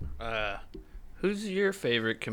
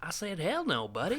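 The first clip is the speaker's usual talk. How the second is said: higher, softer comic impression with a laugh; Cy Amundsen; 6 semitones higher, 4 dB quieter than usual. Quoting a Subway sandwich bit, comic impression. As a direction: imitate the comedian's indignant customer. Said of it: "Dude, Cy Amundsen".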